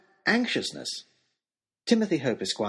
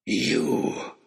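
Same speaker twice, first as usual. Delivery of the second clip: rough gasp of recognition; 4 semitones lower than usual. A narrator's reading. Oxshott catches sight of Nicholas, gasping. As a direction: gasp it out on seeing an enemy. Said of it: "stopped and gasped".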